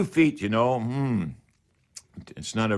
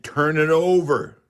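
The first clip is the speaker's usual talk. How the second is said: "turn it over" said higher and louder, leaning on "over"; emphatic instruction; 4 semitones higher, 4 dB louder than usual. Real, not acted. Radio host urging the caller to flip the boards, emphatic. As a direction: hammer home the key step. Host stressing the key step.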